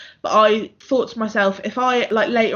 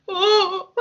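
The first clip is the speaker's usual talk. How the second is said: retold sob; 11 semitones higher than usual. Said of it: "burst out crying".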